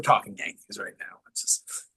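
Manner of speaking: hushed aside, off mic; whispering. Real, not acted.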